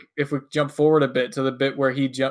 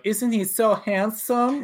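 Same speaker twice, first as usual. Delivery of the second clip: slow, higher-pitched mock girlish gushing; swooning girls; 7 semitones higher, slower than usual. Girls walking out of the classroom, mock swooning over their professor. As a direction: gush like an admiring student, drawn out. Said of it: "they're like".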